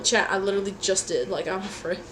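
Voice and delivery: normal talking voice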